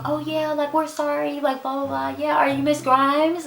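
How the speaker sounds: high, soft, fake-casual apology; glossing over the mistake lightly; playing it off. Front desk staff covering their mix-up, high and soft. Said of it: "trying to, like, play it off".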